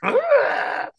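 high mock-spooky cry; imitated spooky noise. Guest imitating how weird the AI videos get, high spooky noise.

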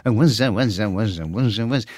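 imitating [name], hissing Z on the S; a lightly voiced mock Bowie, lisping the S as Z; Bowie noise. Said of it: "we had this noise that we would make that became just like the [name] noise".

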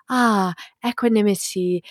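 lightly voiced airy sing-song spiritual type; mock serene priestess. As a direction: tease the blissed-out self, la la la. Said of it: "I'm kind of making fun of them".

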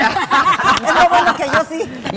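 laughing loudly through the words; slow words carried on a big laugh. Real, not acted. Laughs at own gossip memory. (riendo a carcajadas)

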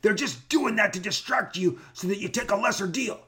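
hammed-up high scolding attorney voice; imitating angry attorney. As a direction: bark it like an irate lawyer grabbing toys. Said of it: "and he goes".

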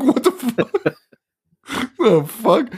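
high-pitched laughing incredulity; baffled disbelief. Acted. Podcaster reacting to Santa cursing for no reason, incredulous. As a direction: squeal it in amused bafflement.

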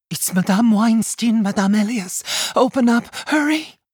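an anxious neighbor urging them to open the door fast; urgent plea. Acted. Neighbor at the door at sunrise, urgent and pleading.

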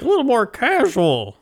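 high, prissy mock tone; a quick hammed-up snob voice; mock fancy voice. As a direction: squeak it out like a snooty diner. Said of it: "They're just like".

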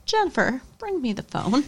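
imitating a tough grandma, quietly firm; unafraid, no hesitation. Imitating Grams ordering Jen to fetch the phone, unflinching. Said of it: "no hesitation, no fear".

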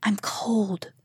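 soft unvoiced whisper; a cold, gentle whisper from the dark. A narrator's reading. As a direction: breathe it out, barely voiced. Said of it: "he heard a soft voice".

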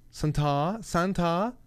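high, rising French-style "san-TAH?"; French accent. Retold or imitated. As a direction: imitate a little girl putting on French. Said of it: "with this French accent".

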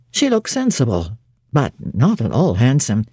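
put-on stern young clergyman judging a stranger, curt and low; terse clergyman. A narrator's reading. St. John voiced by narrator.

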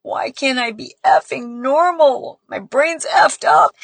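slow tearful cry of frustration rising high; retold anguished outburst. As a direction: echo his despair through her own tears. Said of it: "pounding his fists and saying".

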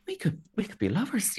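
high-pitched pretend sweetheart; mock lovestruck voice. Contestants flirting once the dust settles, mock lovestruck. Voicing the two shipped contestants.

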